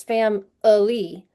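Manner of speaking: "fam-ily" slowly, middle vowel a lazy uh; schwa vowel. Shown as a demonstration. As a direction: sound out "fam-ily" as fam-uh-lee. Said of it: "So that's a schwa".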